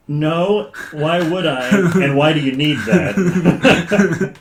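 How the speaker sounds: slow down into disbelief, breaking into laughter; baffled refusal. Retold or imitated. Shopkeeper answering an absurd miniature request, incredulous.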